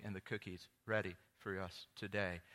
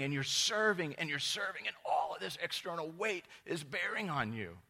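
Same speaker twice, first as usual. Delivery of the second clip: loud, high preaching build; 7 semitones higher, 5 dB louder than usual. Acted. Pastor to congregation, loud and high preaching. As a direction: press the weight of serving on them.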